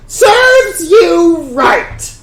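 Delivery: loud, high, drawn-out jeer; jeering crowd shout. Acted. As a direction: yell it from the back of the crowd.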